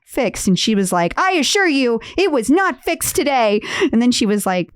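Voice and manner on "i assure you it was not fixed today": high, emphatic retort; mock indignant insistence. Host voicing a customer's text, mock indignant. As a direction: imitate an affronted customer putting you right. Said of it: "This is how it comes across in text".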